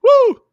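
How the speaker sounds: high, loud whoop; loud woo. Real, not acted. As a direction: whoop like a cheering fan.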